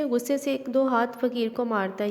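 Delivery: usual speaking voice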